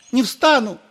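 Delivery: very high, loud refusal; kneeling priest refusing to stand; stubborn priest. A narrator's reading. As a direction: dig in and refuse.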